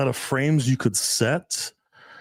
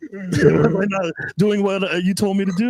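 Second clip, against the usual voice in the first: higher-pitched imitation of a girlfriend; guessing girlfriend; 7 semitones higher than usual. Girlfriend asking if she disobeyed him, lightly imitated. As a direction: voice her anxious-to-know question, pitched up. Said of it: "and she's guessing".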